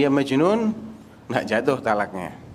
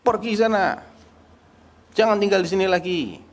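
a mock angry husband, pitched much higher; mock angry dismissal; 7 semitones higher than usual. Husband driving his wife out of the house, mock angry and higher. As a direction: throw her out with a sharp, high mock rebuke. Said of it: "Atau apalagi misalnya".

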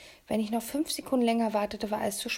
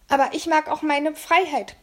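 higher and louder, blurted with a snort; 7 semitones higher, 8 dB louder than usual. A narrator's reading. Kidnapper right in the boy's face, loud snort. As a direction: spit it right in his face. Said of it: "prustete Marak mir mitten ins Gesicht".

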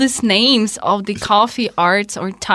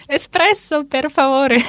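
mock little child ordering; 5 semitones higher than usual. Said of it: "there's like".

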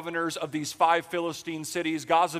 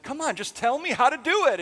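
higher, louder whining plea; mock pleading Delilah; 9 semitones higher, 4 dB louder than usual. Delilah frustrated with Samson, higher mock whine. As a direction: beg him like an exasperated girlfriend.

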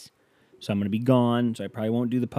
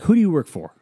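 higher, louder, indignant demand; indignant challenge; 7 semitones higher, 6 dB louder, faster than usual. Retold or imitated. To the pizza driver, indignant shouted question. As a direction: challenge him sharply. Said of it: "and I'm like".

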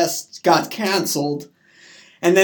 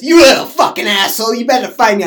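loud, higher mock threat; angry retelling; 5 semitones higher, 8 dB louder, faster than usual. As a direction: act out his furious challenge. Acted quarrel with mom's boyfriend.

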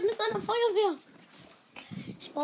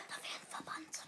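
whispering; 3 semitones lower, 20 dB quieter than usual. Real, not acted. Whispered game instruction.

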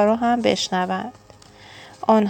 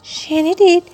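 much higher, indignant outburst; outraged cry; 9 semitones higher, 4 dB louder than usual. A narrator's reading. Mocked friend appealing to the others, outraged cry. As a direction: protest shrilly to everyone listening. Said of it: "فرفرهٔ فریاد زد".

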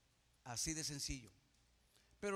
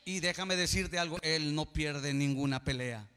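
much louder, shouting sermon peak; preacher roaring to the congregation; fiery preaching climax; 11 dB louder than usual. Acted. Preaching climax at full force.

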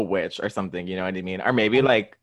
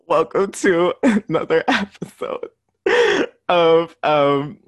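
laughing so hard it sounds like crying; words breaking up with laughter; blubbering; 7 semitones higher, 8 dB louder, slower than usual. Real, not acted. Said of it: "sorry, I'm crying".

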